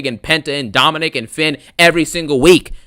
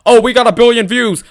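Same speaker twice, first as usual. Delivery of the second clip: mocking brag; 8 semitones higher, 6 dB louder than usual. Mocking WWE's bragging.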